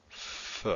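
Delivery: "f" held as a stream of air; continuous f. Shown as a demonstration. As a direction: draw out a voiceless "f" to show it can last. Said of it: "difference between a continuous sound".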